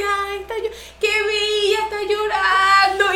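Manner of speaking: high singsong imitation of touched old ladies; mock-tender churchgoer, pitched well up; cooing sympathy, mocked. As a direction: coo with exaggerated pity, stretching the last word. Voicing churchgoers moved by her tears.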